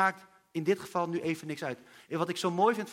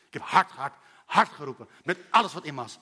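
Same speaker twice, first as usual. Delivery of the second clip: louder, hammering hard; insistent; 4 semitones lower, 5 dB louder than usual. Retold or imitated. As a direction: push each hard out. Bartimeus roept het uit. (luider, met nadruk op hard)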